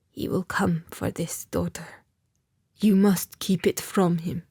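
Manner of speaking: frail, dying elder, mostly whispered; dying old man. Acted. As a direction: breathe it out like a dying man passing a secret. Dying blind old man speaks.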